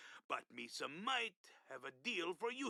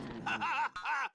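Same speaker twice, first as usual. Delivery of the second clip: booming alien laugh; 8 semitones higher, 5 dB louder than usual. Acted. Game creature laughs after deal.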